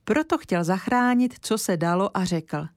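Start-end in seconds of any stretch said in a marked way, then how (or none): none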